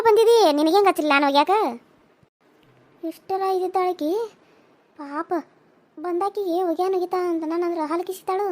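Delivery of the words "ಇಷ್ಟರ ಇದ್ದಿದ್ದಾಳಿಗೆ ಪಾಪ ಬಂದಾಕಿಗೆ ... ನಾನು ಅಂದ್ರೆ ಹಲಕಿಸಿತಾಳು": a quiet, sour aside; muttered complaint. Acted. Daughter-in-law quietly grumbling about her mother-in-law's act. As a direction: grumble softly to herself.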